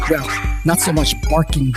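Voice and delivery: ordinary delivery, nothing marked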